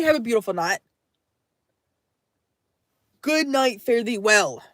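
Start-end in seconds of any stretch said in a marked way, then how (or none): none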